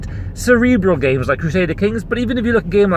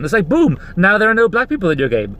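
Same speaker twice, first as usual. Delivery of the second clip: normal talking voice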